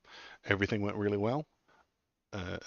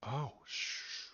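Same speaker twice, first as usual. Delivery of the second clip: hushing whisper; 6 semitones higher, 8 dB quieter than usual. Real, not acted. Hushing the excited dog.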